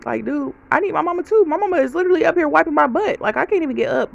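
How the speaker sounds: high-pitched, indignant plea; exasperated protest. Retold or imitated. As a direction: argue back that you need her too. Protesting the aunts' demand on mom.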